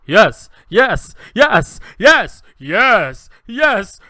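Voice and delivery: loud, hammed-up string of varied takes; overacted, pitch jumping up and down; actor trying every take. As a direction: fire off each one as a new reading. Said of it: "so many directions at the end, he just goes".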